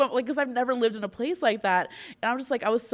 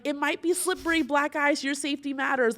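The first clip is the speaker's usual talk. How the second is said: mock cautioning; 7 semitones higher, 3 dB louder than usual. Mimics agency's cautious warning.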